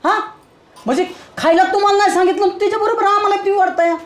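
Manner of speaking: high-pitched, agitated objection; protesting plea. Acted. Family member objects to brother's meat ban, high-pitched protest.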